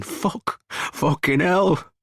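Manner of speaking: breathless, slow, rising shock; gasping for breath. A narrator's reading. Shooter can't breathe after the killings, gasping. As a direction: gasp out the curse, dragging the last words. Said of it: "I'm gasping for breath and saying".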